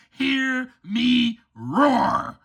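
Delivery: slow, very low playful roar; lion roar. Acted. Lion cub declaring itself a lion, slow low roar. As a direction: draw it out into a low lion growl. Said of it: "for I am a lion".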